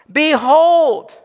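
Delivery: loud, high "Behold" with falling end; ringing "Behold"; proclaimed behold. Shown as a demonstration. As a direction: call out "Behold" to make them stop. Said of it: "That means stop and consider".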